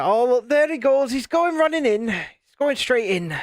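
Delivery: high, excited mock commentary, slowing at the end; mate mimicking a friend charging straight in; John mocking. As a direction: tease like a mate calling it. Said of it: "and John will go".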